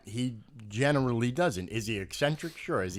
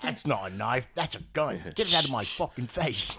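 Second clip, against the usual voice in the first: Crocodile Dundee. Acted. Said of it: "then it's Crocodile Dundee".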